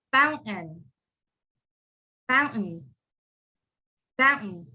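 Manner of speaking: "fountain" as foun, catch, N; silent T. Shown as a demonstration. Teacher demonstrates the rule, silent T in fountain. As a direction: replace the end of "fountain" with N. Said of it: "completely gone and it's replaced with an N".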